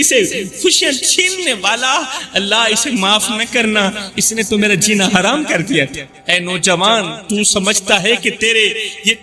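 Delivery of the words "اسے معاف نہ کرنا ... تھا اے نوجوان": ordinary talk, nothing marked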